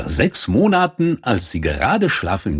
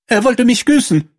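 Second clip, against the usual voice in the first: very high, louder princess voice; protesting princess; 5 dB louder than usual. A narrator's reading. Princess complains to the king about the frog, high-pitched protest.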